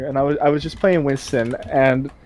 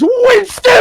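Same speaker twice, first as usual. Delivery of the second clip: much higher and louder, crying shout; screamed name; 5 dB louder than usual. Retold or imitated. Teammate on mic screaming Winston, high shout. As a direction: wail the name at full voice. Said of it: "he turns on his mic and he goes".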